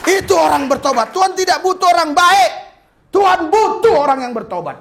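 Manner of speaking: loud, high preaching shout; fiery sermon delivery; full-force preaching. Acted. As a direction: preach it out to the crowd.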